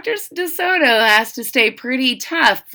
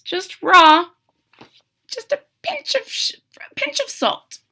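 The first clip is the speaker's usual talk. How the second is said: high, squeaky mimicry of the fox; teacher imitating the fox; mock fox; 11 semitones higher than usual. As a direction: lampoon the greedy fox.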